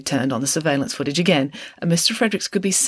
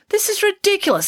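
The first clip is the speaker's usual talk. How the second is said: much higher, sharp indignant snap; snapped; 3 dB louder than usual. A narrator's reading. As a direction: snap it at the detective. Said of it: "snapped Mister Fredericks".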